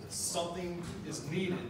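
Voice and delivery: normal talking voice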